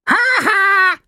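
very high acted "ha ha"; storybook parrot laugh. A narrator's reading. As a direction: laugh mockingly as a high-pitched bird. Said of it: "looking down at him and laughing".

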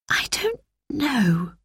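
put-on slow, soft, near-crying girl; a troubled girl barely holding back tears; tearful sigh. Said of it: "sighed Roberta".

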